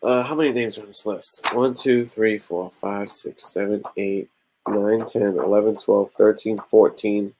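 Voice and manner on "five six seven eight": usual speaking voice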